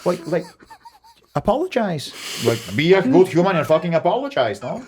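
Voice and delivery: indignant. Retold or imitated. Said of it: "And I'm like".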